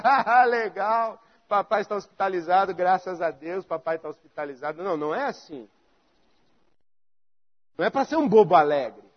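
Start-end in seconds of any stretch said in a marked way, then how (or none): 0.0-4.8 mock silly cheer. Mocking a foolishly cheerful man.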